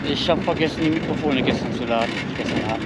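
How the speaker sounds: windy outdoor mic recording; wind on mic. Real, not acted. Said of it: "Sorry für die Windgeräusche".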